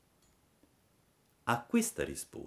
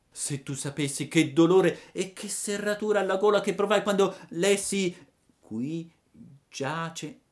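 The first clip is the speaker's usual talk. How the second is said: remember the grave with a lump in throat; Pinocchio grieving; 12 semitones higher, 4 dB louder than usual. Acted. (Pinocchio addolorato, voce alta che si spegne)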